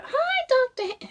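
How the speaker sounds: high, loud, slow drunken cartoon hello; pissed students hailing their professor in a cartoon voice; Simpsons-style greeting. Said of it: "we were just like".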